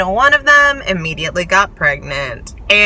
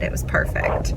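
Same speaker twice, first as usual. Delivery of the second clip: hushed unvoiced whisper; whispered awe; 6 dB quieter than usual. Real, not acted. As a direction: breathe it out in awe. Awed verdict on ending.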